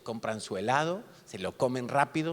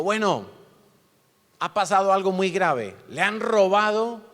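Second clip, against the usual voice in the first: higher, louder drill-sergeant speech; a bit of a sergeant; 6 semitones higher, 6 dB louder than usual. Retold or imitated. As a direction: lay down the law like a camp sergeant. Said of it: "como un poquito sargento".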